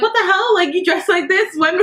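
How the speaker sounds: high-pitched, scandalized quote; mock incredulous friends. Friends' shocked reaction retold.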